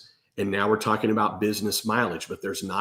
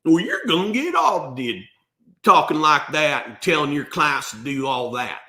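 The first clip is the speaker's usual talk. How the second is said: higher, louder mock old-timer warning; older tax pros' talk; 4 semitones higher, 4 dB louder than usual. Older guys come up after a seminar, mock warning. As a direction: ham up the grumpy old tax pro. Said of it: "And they usually talk like that".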